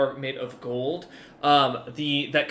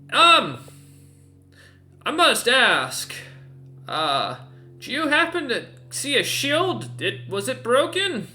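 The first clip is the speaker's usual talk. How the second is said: ogre's voice; 10 semitones higher, slower than usual. Acted. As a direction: speak down from the wall, wary but polite. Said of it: "leans back over and goes".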